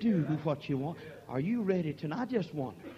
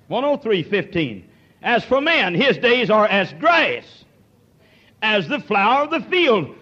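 preached scripture; 7 semitones higher, 10 dB louder than usual. Real, not acted. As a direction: thunder the verse to the congregation. Preaching scripture at full force.

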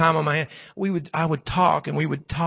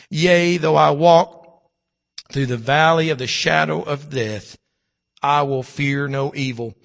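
slow, solemn psalm recitation; reciting scripture; slower than usual. Acted. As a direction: let each phrase of the psalm land.

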